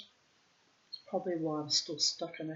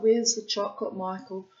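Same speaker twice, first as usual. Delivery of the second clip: 2 loud, raised demand for the hidden chocolate; 3 call out for it, higher and louder; 4 semitones higher, 7 dB louder than usual. Retold or imitated. 4 wife demanding hidden chocolate from her husband, loud and raised.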